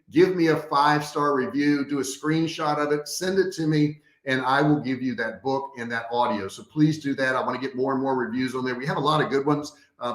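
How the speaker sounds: ordinary talk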